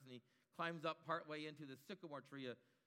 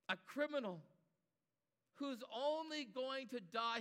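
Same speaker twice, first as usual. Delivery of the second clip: normal talking voice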